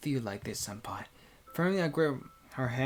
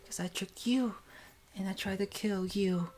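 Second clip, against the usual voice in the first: tearful, higher and whispery; crying voice; 6 semitones higher than usual. Acted. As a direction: plead through held-back tears. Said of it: "Suddenly she speaks in a crying voice".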